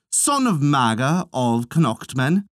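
plain reading voice

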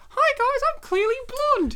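high, loud ditzy-blonde impression; Christina Applegate. Podcaster playing an actress in a mock reenactment, high loud impression. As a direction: put on a very high-pitched, showy voice. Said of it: "I'll be Christina Applegate".

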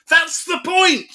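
high, drawn-out yell of frustration; exasperated shout. Retold or imitated. Call handler fed up explaining to customers, exasperated shout. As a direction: shout it slowly, at the end of your tether.

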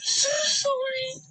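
slow tearful sobbing plea; crying. Real, not acted. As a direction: cry it out, pleading. Girl crying to officer.

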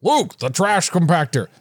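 a light stab at Harrison Ford; half-hearted Han Solo; Han Solo impression. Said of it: "Casey wants to hear me do an impression".

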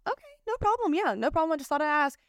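high, airy, breezy okay-no-problem; a high, carefree brush-off. Acted. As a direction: let it go lightly, sing-song. Brushing off a declined invite.

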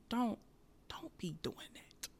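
soft, slow whispered warning; hushed soft caution. Real, not acted.

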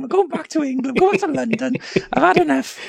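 a fed-up king in a silly high voice; Henry the Fourth voice. Pretend. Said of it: "the same Henry the Fourth voice".

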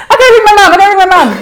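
fiancée squealing, demanding his phone to call mum; excited squeal. Retold or imitated. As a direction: burst with newly engaged joy. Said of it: "I said".

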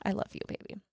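soft unvoiced whisper of affection; whispered love. Real, not acted. Mom whispering love to her child, soft whisper.

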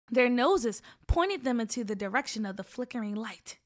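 ordinary delivery

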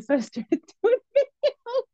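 high, laughing through the words; can't stop laughing while telling it; high giggling telling. Real, not acted. Laughing while telling how she started.

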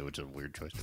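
ordinary talk

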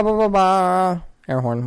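high, slow honking ba ba ba; air horn imitation. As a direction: honk it out like a party air horn. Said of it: "we gotta have the ba".

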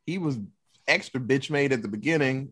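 ordinary talk, nothing marked

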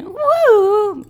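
long, high-pitched mock cheer; high "woo". Real, not acted. Host cheering her chit chat topic, high drawn-out woo.

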